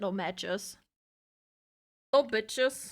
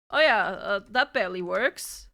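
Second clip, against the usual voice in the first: usual speaking voice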